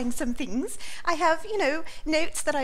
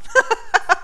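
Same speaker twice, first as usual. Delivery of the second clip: loud high laugh; burst of laughter; 9 semitones higher, 8 dB louder than usual. Real, not acted. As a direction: break into real laughter. Wife loses track, laughs.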